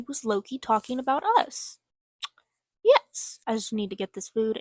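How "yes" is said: loud, high excited yes. Real, not acted. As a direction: cheer it out. Excited cheer mid-story.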